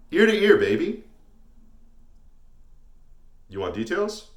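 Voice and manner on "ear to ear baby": loud, high, cocky crowing; swaggering high-pitched boast; gloating. Acted. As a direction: crow it to the room. Gloating about his smile.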